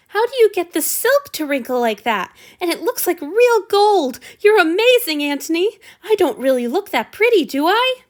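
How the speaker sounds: put-on high, loud eager boy; delighted Hanyu. A narrator's reading. Hanyu praising Antony's painting, high and thrilled. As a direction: gush over the painting.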